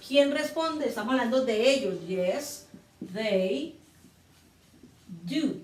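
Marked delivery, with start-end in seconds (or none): none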